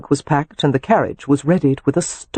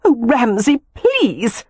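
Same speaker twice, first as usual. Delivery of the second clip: high, exasperated woman's plea; mildly heated, pitched-up objection; 9 semitones higher, slower than usual. A narrator's reading. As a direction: protest with some heat. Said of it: "she said heatedly".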